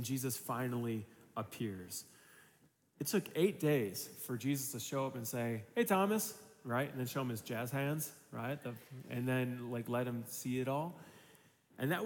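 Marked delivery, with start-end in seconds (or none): 5.7-6.4 high-pitched hailing call; light, pitched-up hello; imitated cheerful greeting. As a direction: greet a friend with playful surprise. Acts Jesus greeting Thomas on arrival.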